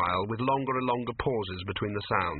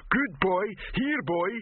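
cooing troll; 9 semitones higher, slower than usual. A narrator's reading.

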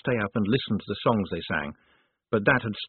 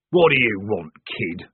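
growled; 4 dB louder than usual. Acted. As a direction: growl it like a surly corporal. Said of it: "growled the corporal".

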